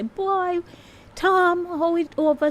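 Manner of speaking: high, lively Jamaican patois greeting. Retold or imitated. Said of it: "people come up to you and say".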